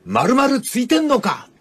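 high mock-tough scolding voice; rough male rebuke. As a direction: bark it like a drill sergeant dressing down a recruit. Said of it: "こういう言い方がありますよね".

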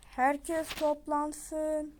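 very slow, drawn-out call to gather; drawn-out announcer call to all crewmates; slow announcement. Acted. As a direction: call everyone in slowly like a loudspeaker. Announcer calls everyone.

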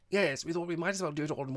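put-on loud, high, hearty girl's voice; breezy young visitor. A narrator's reading. Girl explains the surprise visit, loud and breezy. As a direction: rattle it off cheerfully.

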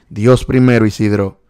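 fervent loud shout-out; loud proclamation. Real, not acted. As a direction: proclaim it to the viewer. Loudly echoing viewer's faith.

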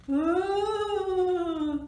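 high, loud, long ooh; spooky ghostly ooh. A narrator's reading. Reader making the spooky sound for children, ghostly ooh. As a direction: wail like a storybook ghost. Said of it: "They heard a spooky sound".

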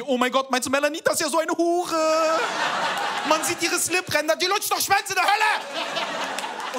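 high, loud shrieking gossip; mock-outraged shouted gossip; gossiping outrage. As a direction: screech it like a furious girlfriend venting. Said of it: "sie möchte Sachen hören wie".

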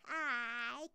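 usual speaking voice

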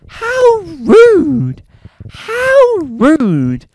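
hammed-up offended shriek; theatrical indignation, high and loud; mock outrage.

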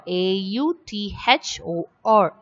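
letter by letter, evenly spaced; spelling out letters. Shown as a demonstration. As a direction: spell each letter out separately.

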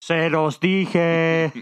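long drawn-out loud holler; loud, stretched I-told-you-so yell; shouting. Acted. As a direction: call it out slowly through cupped hands. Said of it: "lo gritaré ahuecando las manos".

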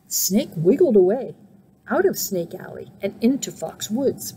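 plain reading voice

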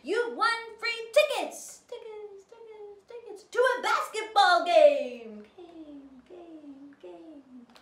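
high, loud voice with fading echoes; echoing announcement. Acted. Reading the prize note, loud with echoes. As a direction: ring out each name like a booming echo.

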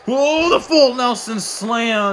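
high, slow, drawn-out call; impressed commentator cry; awed exclamation. Real, not acted. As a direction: stretch the move's name in amazement. Calling a big slam.

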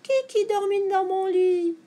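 high, loud whine; high-pitched whimpering complaint; tearful little bear. A narrator's reading. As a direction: wail it like a crying baby bear. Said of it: "pleure Chignache Petit Ours".

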